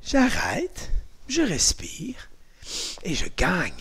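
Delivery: breathy whisper, slow and soft; whispered calm-down. Shown as a demonstration. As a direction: whisper it as if calming yourself. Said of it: "Concentrer le corps".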